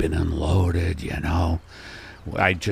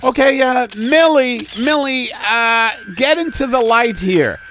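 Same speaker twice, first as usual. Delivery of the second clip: high, raised photographer voice; fussy photographer; 7 dB louder than usual. Acted. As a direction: direct your model from across the studio. Photographer directs model in a skit.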